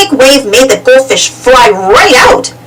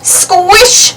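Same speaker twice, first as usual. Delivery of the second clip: plain reading voice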